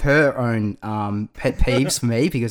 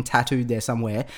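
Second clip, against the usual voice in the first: ordinary delivery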